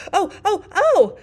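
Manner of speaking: loud, high-pitched screamed cries; shouting in pain. A narrator's reading. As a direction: shriek like a steam whistle. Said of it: "shouted the Queen".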